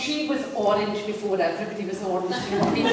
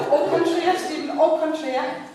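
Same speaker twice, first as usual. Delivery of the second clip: mock-posh French; 8 semitones higher, 5 dB louder than usual.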